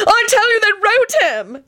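very high, drawn-out shriek without much volume; screamed. A narrator's reading. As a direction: screech it insistently, pitch way up. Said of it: "Antonin screamed".

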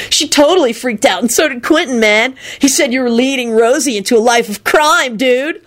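lilting, gleeful tattling; gleeful dude. A narrator's reading. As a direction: relish telling the scandal. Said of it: "Kenny responded gleefully".

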